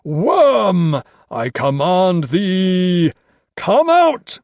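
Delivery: slow, loud summoning, rising very high; commanding cry. A narrator's reading. Carstairs calls the Worm out, crying commands. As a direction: summon the beast with full force. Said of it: "Carstairs cried".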